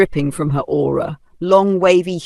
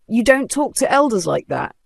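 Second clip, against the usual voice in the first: angry; 7 semitones higher than usual. A narrator's reading. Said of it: "spoke angrily".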